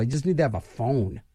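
ordinary talk

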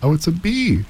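high, delighted exclamation; high surprised cry. Acted. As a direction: Miss Lowe startled by a bee. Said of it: "she cried".

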